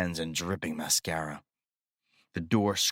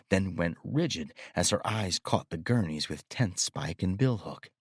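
ordinary delivery, nothing marked

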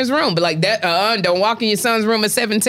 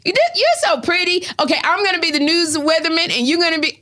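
put-on much higher-pitched child voice; little son; 10 semitones higher than usual. Young son playing with his mommy, high little-kid voice. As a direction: imitate a small child chattering to mom. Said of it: "I picture him going".

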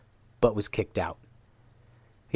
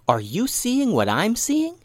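slow, high, incredulous asking; high-pitched, drawn-out disbelief; astonished question; 12 semitones higher, slower than usual. Acted. One ninja asks the other about a strange sight, astonished.

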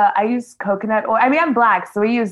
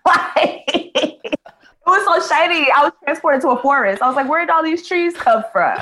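laughing mock awe; 7 semitones higher than usual. Shown as a demonstration. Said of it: "give you the read".

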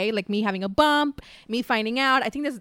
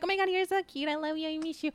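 much higher and softer cooing; cutesy pet talk; 7 semitones higher, 6 dB quieter than usual. Shown as a demonstration. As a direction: coo softly up high like to a tiny pet. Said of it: "I start talking like this around them".

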